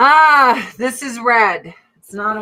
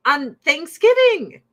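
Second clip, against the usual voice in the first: higher, incredulous rant at the husband; mock outrage; 7 semitones higher than usual. Scolding her Nordic husband over repeat dinners, mock outrage. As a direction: ham up the disbelief. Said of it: "I'm like".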